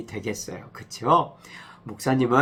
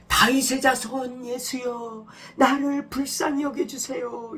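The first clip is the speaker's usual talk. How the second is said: blind man crying out; 11 semitones higher than usual. Acted. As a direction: call out desperately for help, pitched way up. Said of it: "소리를 크게 질렀어요".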